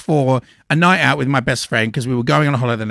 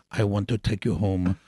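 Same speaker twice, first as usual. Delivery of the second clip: imitated Brazilian accent; 6 semitones lower than usual. Retelling a Brazilian man's come-on, imitated accent. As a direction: put on a vague, sultry Brazilian accent. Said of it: "I don't know what accent that was".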